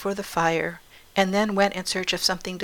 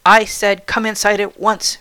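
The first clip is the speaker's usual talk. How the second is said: louder, forceful stern command; insistent repeated order; 2 semitones higher, 8 dB louder than usual. A narrator's reading. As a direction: insist as if losing patience. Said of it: "repeated the woman".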